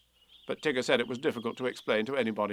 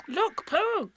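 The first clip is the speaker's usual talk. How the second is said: high, small, startled piglet voice; sudden Piglet; 4 dB louder than usual. A narrator's reading. Piglet spotting something in a tree, sudden high pipe. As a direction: pipe up all at once. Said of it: "said Piglet suddenly".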